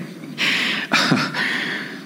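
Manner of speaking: soft breathless laugh; real laugh. Real, not acted. Preacher laughing at his counseling story. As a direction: let a quiet laugh slip out.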